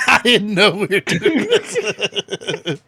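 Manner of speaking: laughing while talking; words through a laugh. Real, not acted. Surprised by the noir intro.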